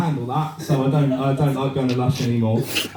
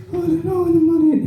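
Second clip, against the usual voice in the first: very high, quick squeaky pleading; frightened little toy; 13 semitones higher, faster than usual. Pretend. Bath toy pleading with its mob interrogator, high panicked squeak. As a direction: squeal it fast like a tiny terrified captive. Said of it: "And he's like".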